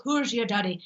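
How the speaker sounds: demonstrated Scottish lilt; Scottish accent. Shown as a demonstration. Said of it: "so the Scottish goes".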